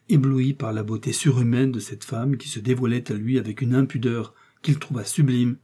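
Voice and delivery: usual speaking voice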